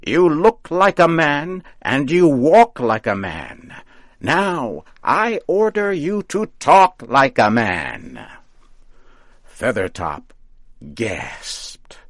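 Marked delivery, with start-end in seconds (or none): 0.0-8.3 high, loud commanding witch; witch shouting orders. A narrator's reading. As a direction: bark the orders at him. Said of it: "Mother Rigby shouted and ordered him on".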